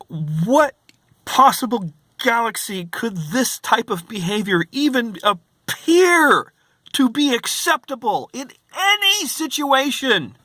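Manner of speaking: high, disbelieving rising outrage; how is this even possible, voice climbing; venting disbelief to viewers; incredulous. Real, not acted. Incredulous rant.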